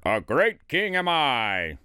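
slow, drawn-out royal boast; lightly chanted self-praise; self-satisfied singsong. A narrator's reading. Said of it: "sang over and over".

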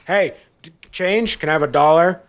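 high, loud mock begging voice; begging woman. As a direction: pester each car for a dollar.

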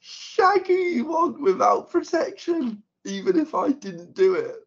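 high, loud, cracking into laughter; trying not to laugh. Shown as a demonstration. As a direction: straight face collapsing into giggles. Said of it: "we gotta try not to laugh".

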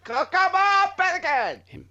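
very high, drawn-out comic cry; hammed-up high voice, stretching the name; mock high-pitched call. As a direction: call it out in a put-on squeal. Joking mock impression of wrestling lines.

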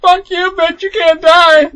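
high, loud, drawn-out retort; mock angry yell. Player answering the game ally, mock angry high yell. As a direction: shout back in hammed-up exasperation.

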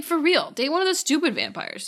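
usual speaking voice

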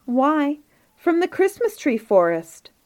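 high-pitched, lilting reply; matter-of-course surprise. A narrator's reading. Household answering visitors about the toys, surprised reply. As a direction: answer as if it were obvious, voice lifted.

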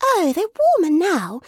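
put-on high little-child voice; child's exclamation. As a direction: pipe up eagerly as a small child. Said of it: "exclaimed the child".

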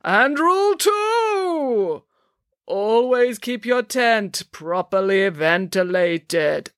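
drawn-out, high ringing proclamation; Madame Zandra. A narrator's reading. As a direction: announce the rule grandly, very slowly. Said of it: "intoned Zandra".

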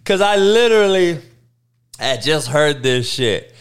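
usual speaking voice

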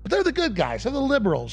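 high, quiet sarcastic sneer. Acted. Mocking liberals' self-image.

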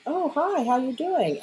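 put-on high cooing hello, like baby talk; sweet greeting to a dolphin. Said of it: "I was like".

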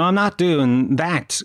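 usual speaking voice

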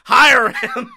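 hammed-up boss calling the hire; mock order. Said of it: "WWE was like".

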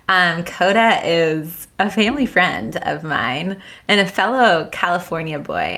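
usual speaking voice

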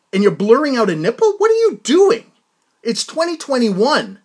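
host venting, half shouting at the magazine; getting mad. Real, not acted. Podcast host scolding the magazine over the censored nipple, outraged and loud. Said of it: "Sorry, I get mad".

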